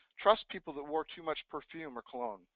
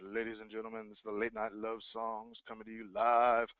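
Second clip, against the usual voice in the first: lower, soft, sultry late-night DJ purr; sexy radio voice; 6 semitones lower, 3 dB quieter than usual. Shown as a demonstration. Coach mocking an agent's sexy voice, low late-night radio purr. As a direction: seduce the listener like a late-night love-songs host. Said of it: "Are you using a little sexy voice?".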